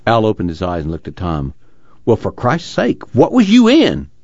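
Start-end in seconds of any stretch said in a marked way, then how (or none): 2.0-4.1 high, loud shocked outburst. Acted. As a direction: blurt it out in alarm. Al startled by Tom's face.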